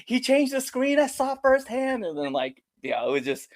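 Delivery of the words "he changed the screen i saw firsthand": very high-pitched call-out; tattling accusation. Retold or imitated. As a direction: tell on him, voice shooting up. Wrestler tattling on boyfriend.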